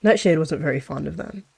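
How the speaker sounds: ordinary delivery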